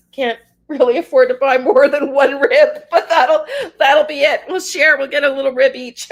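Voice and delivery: laughing while talking about the ribs. Real, not acted.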